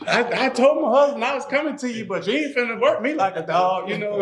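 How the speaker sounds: high, quick, teasing lady voice; mock client lady. Client lady teasing she told her husband, high quick mock voice. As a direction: play the client lady, high and fast with a laugh.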